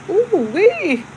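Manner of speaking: high, delighted squeal; excited ooh wee. Real, not acted. Thrilled that viewers stayed since the start, high squeal. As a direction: let it burst out in a high happy squeal.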